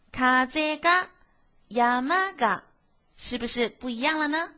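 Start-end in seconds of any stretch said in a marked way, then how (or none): none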